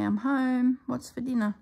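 high, loud voiced cat announcing himself; cheeky cat strolling in home. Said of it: "if to say".

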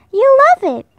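high, bright coaxing call; hippo family inviting. Acted. Hippo family calling him in.

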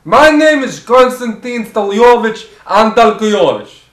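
put-on loud Russian-accented host, settling as it goes; emphatic put-on Russian cooking-show voice; enthusiastic Russian chef. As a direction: announce the dish in a thick Russian accent.

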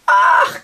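loud acted shriek; screech. A narrator's reading. Giantess screeching as the spider climbs her, loud shriek. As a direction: scream out in sudden horror. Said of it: "kreischte Grauseldis".

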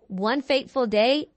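usual speaking voice

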